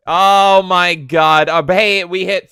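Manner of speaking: drawn out oh my God; loud, slow amazed gasp; excited. Real, not acted. Reacting to donation.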